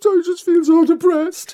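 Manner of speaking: high, loud hammed-up sobbing voice; mock weepy wail. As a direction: play her crying over the top. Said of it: "She said".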